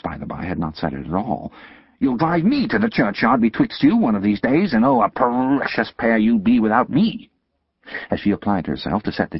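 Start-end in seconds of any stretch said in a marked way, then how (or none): 2.0-7.3 higher, loud shrewish scolding; scolding sister. Acted. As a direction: berate the boy like a furious older sister. Sister laments at both.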